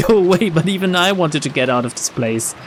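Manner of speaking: ordinary talk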